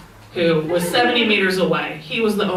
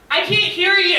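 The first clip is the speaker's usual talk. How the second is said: high, projected call; calling back; 11 semitones higher than usual. Retold or imitated. Dog owner to man seventy meters away, high calling voice. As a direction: call across a long distance.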